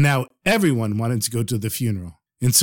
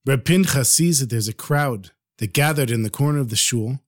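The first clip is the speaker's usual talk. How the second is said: usual speaking voice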